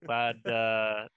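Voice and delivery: glitchy robotic audio dropout; robot voice from a bad connection. Real, not acted. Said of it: "little robot voice".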